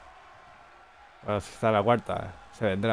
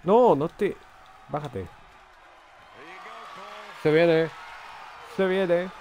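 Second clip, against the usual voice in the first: excited; 6 semitones higher, slower than usual. Real, not acted. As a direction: build the anticipation. Anticipa el movimiento del luchador. (anticipación emocionada)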